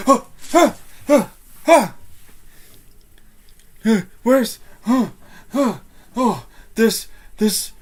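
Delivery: high, loud gasping guard in a fright; acted panic. Guard thinks phantoms are around and must alert everybody, panicked. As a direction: stammer in alarm, building to a rushed cry.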